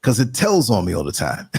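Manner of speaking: ordinary talk, nothing marked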